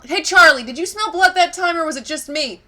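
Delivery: high, loud chipmunk call. Acted. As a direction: shout over to Charlie, high. Chipmunk calls out to friend.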